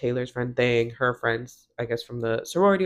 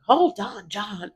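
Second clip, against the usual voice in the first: mock irritated brush-off, high start dropping off; 5 semitones higher, slower than usual. Laurel to John in the background, irritated. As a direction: imitate an annoyed wife cutting him off. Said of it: "she's really irritated with him".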